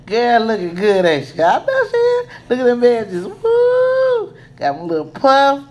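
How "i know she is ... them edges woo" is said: excited squeal. Real, not acted. Admiring the finished hairdo.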